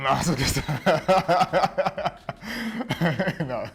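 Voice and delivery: laughing it off; loud laughing admission of a joke. Real, not acted. Teasing joke about colleague.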